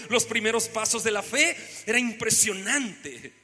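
ordinary talk, nothing marked

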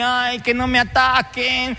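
a hint of a timid whiny coward, slow; mock the fearful whiner. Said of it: "si uno es un pusilánime". (imitación burlona de un cobarde)

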